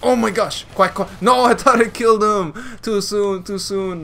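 high, loud cry of dismay; dismayed outburst. Real, not acted. Gamer who quacked too soon before the kill, dismayed outburst. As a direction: exclaim it high, protesting the bad luck. Thought he killed him, dismayed.

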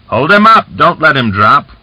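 loud, high-pitched command; urgent shout. A narrator's reading. Cossack orders helpers holding the body, urgent shout. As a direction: bark orders, voice leaping up.

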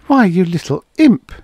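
high, loud fond scolding; mock scolding. A narrator's reading. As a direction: scold the child with relieved affection. Scolding relieved after a scare.

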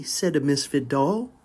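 plain reading voice

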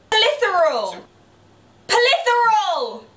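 Polytheral called out slow and high; loud, drawn-out Polytheral. Shown as a demonstration. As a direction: stretch Polytheral out loudly. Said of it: "If you shout".

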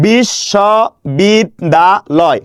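বিশ্ববিদ্যালয় split into five slow beats, a short pause after each; syllable by syllable. Shown as a demonstration. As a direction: sound out বিশ্ববিদ্যালয় one syllable at a time. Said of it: "আমি আবার করে দেখেন". (বিশ্ববিদ্যালয় থেমে থেমে উচ্চারণ)

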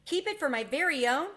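barely holding in his joy; thrilled mouse checking the offer is real; excited. A narrator's reading. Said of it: "could scarcely contain his excitement".

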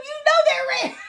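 high-pitched insisting; mock indignant protest. Mother scolding child over curtain color, high indignant protest. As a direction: argue it back, high and loud.

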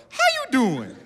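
put-on very high little-kid catchphrase; toddler copying Wendy Williams. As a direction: imitate a child parroting a talk-show greeting. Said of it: "I taught my baby that".

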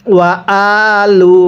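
normal talking voice

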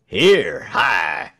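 loud soldiers' hail; loud challenge, dropping in pitch on the second word; shouting. A narrator's reading. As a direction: shout to halt someone. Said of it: "shouted the soldiers".

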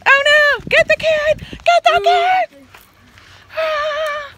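alarmed shout. Real, not acted.

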